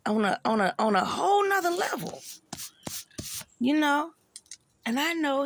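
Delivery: high, loud and near tears; the hurt breaks through; voice cracking. Real, not acted. Man recalling his near-death, voice cracking into tears. Reliving being done dirty.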